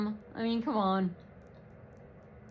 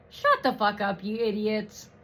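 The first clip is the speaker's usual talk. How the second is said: usual speaking voice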